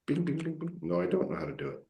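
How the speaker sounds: ordinary talk, nothing marked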